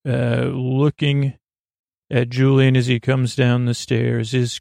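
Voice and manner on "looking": plain reading voice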